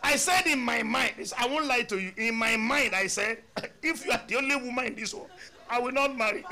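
lively, amused inner thought; animated confession. Retold or imitated. Confesses his inner reaction.

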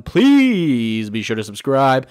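loud, drawn-out opening words that swoop down; playful, exaggerated sign-off plea; goofy put-on voice. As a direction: stretch the first word of each plea, hammed-up. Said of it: "I don't know why I'm doing that with my voice".

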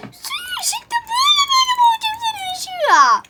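very high shrieked rant; high-pitched playful tirade; hammed-up threat. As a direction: screech a mock threat at the game. Ranting threat at the game.

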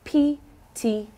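P T K said slowly, each letter apart; teacher drilling the letters slowly; slow demo. Shown as a demonstration. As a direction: spell out P T K slowly. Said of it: "Say it with me".

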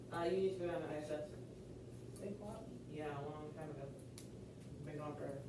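low murmured aside to one student; quiet aside. Real, not acted. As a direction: drop the voice for a side remark.

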